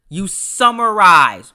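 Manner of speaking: "summarize" stretched long and loud; slow, booming hit on "summarize"; emphatic instruction. Real, not acted. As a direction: drive home "summarize", drawing it out. Repeats key advice word emphatically.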